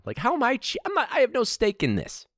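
high-pitched, protesting comeback; exasperated self-defence; indignant retort. Retold or imitated. Retorting to accusing kids.

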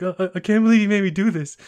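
imitating a mortified stammer; embarrassed. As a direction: protest in hammed-up embarrassment, fast and loud. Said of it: "then Daigo's all embarrassed".

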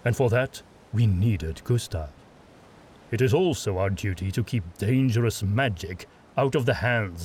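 put-on a proud father setting a young man straight; stern fatherly lord. Adomir lectures Ludlov.

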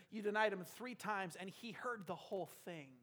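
ordinary delivery

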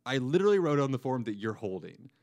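pointing out the obvious with a touch of anger; retold mild annoyance. Retelling how he snapped at his doctor, mildly annoyed.

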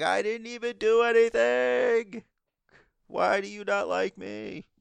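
high, drawn-out wail of a wronged man; whiny mock protest. Podcaster mimics the man being beaten, high whiny mock protest. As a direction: plead it way up high, stretching the words. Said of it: "And he's just like".

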